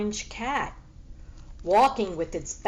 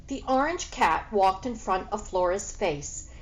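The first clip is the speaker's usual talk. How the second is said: ordinary delivery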